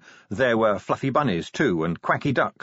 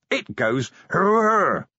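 high, slow, louder wild yell; wild cry; 8 semitones higher, 3 dB louder, much slower than usual. A narrator's reading. Vimes at the guards, wild yell. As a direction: roar it as the axe flies.

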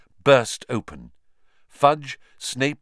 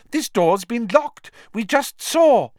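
raised, loud protest; insistent; 7 dB louder than usual. A narrator's reading. Minister reasoning with a raging professor, insistent. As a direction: argue back high and loud. Fudge arguing with raging Snape.

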